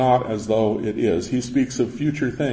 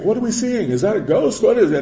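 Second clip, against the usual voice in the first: mock fright; 8 semitones higher than usual. Frightened disciples on stormy night.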